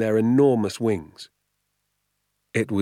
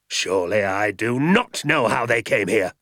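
a mild mock snap, a bit louder; snapping; 3 dB louder than usual. A narrator's reading. Hazel snaps back at the father, sharp. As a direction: bite the words back. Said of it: "snapped Mister Hazel".

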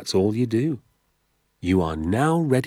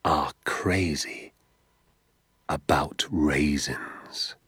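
slow, breathy secret whisper; 5 semitones lower, 4 dB quieter, much slower than usual. A narrator's reading. Father confiding the big secret to his son, slow whisper. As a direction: let the last word fade into a whisper. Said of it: "he whispered".

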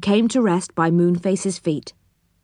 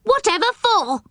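very high, incredulous question; astonished. Acted. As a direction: squeak it out in astonishment. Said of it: "more astonished than ever".

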